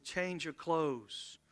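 very slow, each word spaced; "Change your clothes" drawn out slowly, stress on "change"; slow, deliberate. Shown as a demonstration. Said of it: "Secondly, he said".

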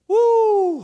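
high, loud excited whoop; loud joyful whoop from the pulpit. Real, not acted. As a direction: let out a joyful preacher's whoop.

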